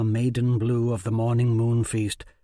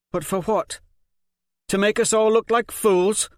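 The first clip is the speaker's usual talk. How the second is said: much higher, louder demand; bitter challenge; 11 semitones higher, 6 dB louder than usual. Acted. Challenges the faith, higher bitter demand. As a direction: challenge it hard.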